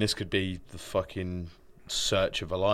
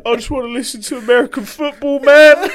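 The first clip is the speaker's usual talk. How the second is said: mock voice; 13 dB louder than usual. Voicing a mocked figure.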